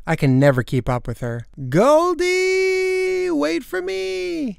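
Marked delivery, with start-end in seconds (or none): 1.7-4.5 high, drawn-out distant call. Acted. Girl calling after her fast pet, high drawn-out call. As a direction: call after someone racing away, long and high.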